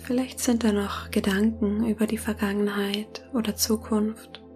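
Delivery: soft spa whisper. Acted. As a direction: whisper gently, guiding them into calm. Leitet eine Meditation. (sanftes Meditationsflüstern)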